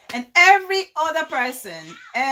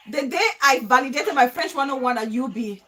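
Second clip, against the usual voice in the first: ordinary talk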